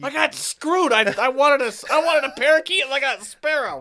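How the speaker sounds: put-on whiny kid voice. Whining sold a fake parakeet.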